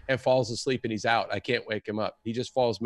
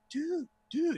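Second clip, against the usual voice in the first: high, soft dazed protest; stoned dude; 6 dB quieter than usual. Retold or imitated. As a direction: whine it softly, pitched way up, like a stoner. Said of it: "He was stoned".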